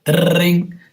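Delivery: high, loud trilled phone ring; imitated phone ring. Acting out her call coming in, mimicked ringtone.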